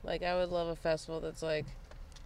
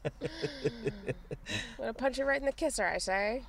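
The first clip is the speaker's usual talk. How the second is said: high, fast old-timey cartoon voice; vintage cartoon bruiser threat; 1940s cartoon tough guy; 6 semitones higher than usual. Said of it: "That's the only impression that we can do".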